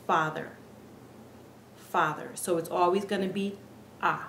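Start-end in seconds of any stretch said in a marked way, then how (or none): 4.0-4.2 A as one pure open "ah"; Spanish A vowel. Shown as a demonstration. Teacher showing students the Spanish vowel, A said as "ah". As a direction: say A as the "ah" in father. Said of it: "pronounced like the A in".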